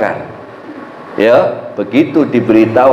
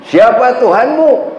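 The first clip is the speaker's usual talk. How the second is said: angels questioning; 9 semitones higher, slower than usual. Acted. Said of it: "Munkar Nakir bertanya".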